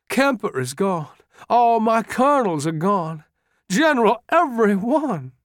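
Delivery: put-on grief pouring out like a lost boy; tearful anguish. A narrator's reading. General listing his dead officers to Lee, high tearful lament. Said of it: "Pickett said tearfully".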